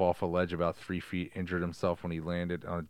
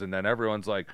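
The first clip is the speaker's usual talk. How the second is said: ordinary talk, nothing marked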